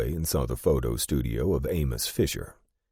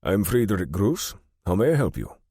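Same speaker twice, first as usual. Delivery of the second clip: put-on higher-pitched German-accented banker; formal German-accented introduction, pitched up; 6 semitones higher, 4 dB louder than usual. A narrator's reading. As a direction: greet a visitor in a noticeable German accent. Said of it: "In a noticeable German accent".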